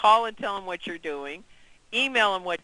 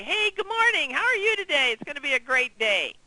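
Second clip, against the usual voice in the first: much higher, bright upbeat good morning; cheerful greeting; 7 semitones higher than usual. Shown as a demonstration. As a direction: greet the agent like it's showtime. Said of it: "it's your job to say".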